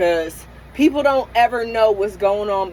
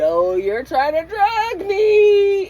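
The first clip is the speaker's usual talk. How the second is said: playful accusation; 9 semitones higher, 4 dB louder, much slower than usual. Retold or imitated. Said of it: "and I laughed".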